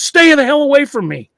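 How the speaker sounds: high, loud warning yell; retold yell. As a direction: yell it out to scare off a stalker. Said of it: "What was it he yelled".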